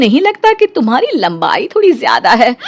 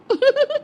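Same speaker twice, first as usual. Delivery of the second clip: high giggle; 8 dB quieter than usual. Acted. Bride laughs off the jab.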